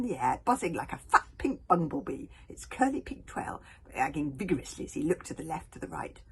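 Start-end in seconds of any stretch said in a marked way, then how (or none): none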